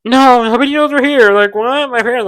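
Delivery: mock panic. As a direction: act out alarm at being found out. Acts out Shane's alarm at discovery.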